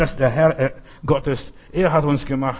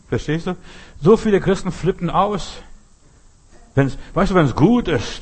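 ordinary delivery

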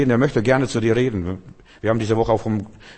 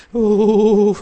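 high, loud, drawn-out "oh" howl; mock wail; 10 semitones higher, 5 dB louder than usual. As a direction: wail in parody of mournful worship. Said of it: "wird geheult".

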